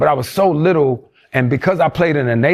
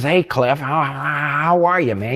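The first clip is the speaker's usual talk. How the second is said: imitating Mr. Redden; slower than usual. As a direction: mimic the drama teacher's greeting. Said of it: "He talked just like this".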